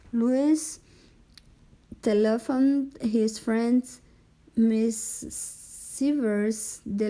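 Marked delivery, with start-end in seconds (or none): none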